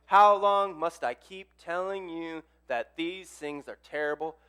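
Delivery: slow lament to God, ending very drawn out; calling out to God. Acted. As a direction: start loud and slow, sink into a weary plea. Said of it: "Habakkuk is calling out about".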